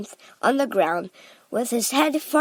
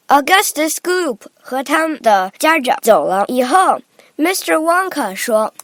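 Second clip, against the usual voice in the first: plain reading voice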